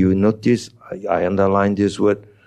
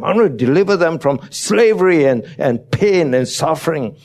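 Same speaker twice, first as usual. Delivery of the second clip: God's passionate promise; 7 semitones higher than usual. Acted. God telling Moses of rescue, urgent raised voice. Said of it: "God said".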